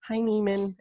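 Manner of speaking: high-pitched schoolkid greeting; unbroken boy voice. Retold or imitated. Younger schoolmate greeting a friend before summer, imitated high kid voice. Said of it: "before the summer, they'd be like".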